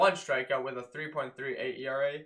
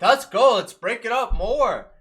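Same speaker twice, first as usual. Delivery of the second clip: loud, raised rallying cry; excited cheer; 5 semitones higher, 9 dB louder than usual. Retold or imitated. Fan reliving a rally, excited cheer.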